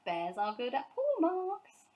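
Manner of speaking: proudly spelled out; eager boast. A narrator's reading. Paddington eager.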